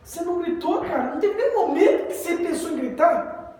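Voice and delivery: high-pitched acted disbelief; mock astonished questioning; incredulous. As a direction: ask in hammed-up amazement, voice shooting up.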